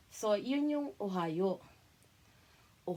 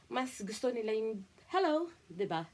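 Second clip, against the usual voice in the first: ordinary talk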